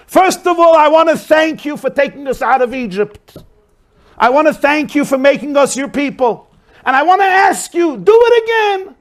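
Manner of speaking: high, loud pleading cry to heaven; impassioned plea to God. Acted. Moshe cries out to God to take them out again, high and loud. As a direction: beg heaven at the top of your voice.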